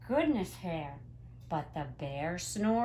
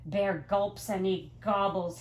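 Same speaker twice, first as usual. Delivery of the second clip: plain reading voice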